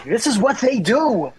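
incredulous high-pitched outburst; exasperated retort. Retold or imitated. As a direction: fire back at the brother in disbelief. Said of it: "I go".

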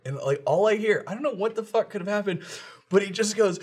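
ordinary talk

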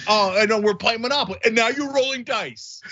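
高声假装委屈抱怨; mock whiner. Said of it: "it's like saying".

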